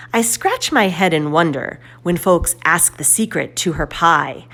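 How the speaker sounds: usual speaking voice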